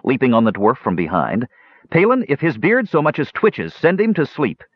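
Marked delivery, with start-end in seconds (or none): 1.9-4.6 higher-pitched urgent command; ordering. A narrator's reading. Tannen threatens the captured dwarf, ordering his brother. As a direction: order your brother firmly. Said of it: "Tannen ordered".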